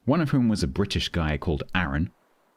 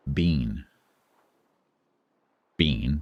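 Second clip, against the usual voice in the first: "Bean" in an amateur American accent; attempted American accent; 4 semitones lower than usual. Shown as a demonstration. Streamer testing whether the words differ, attempted American accent. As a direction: try "Bean" the American way, lower. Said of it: "my American accent is not that great".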